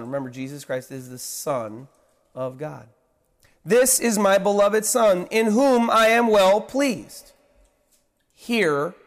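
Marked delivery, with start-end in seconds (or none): 3.6-8.9 booming, higher and louder voice of God. Pretend. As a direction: proclaim it from the heavens.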